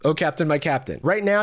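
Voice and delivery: ordinary delivery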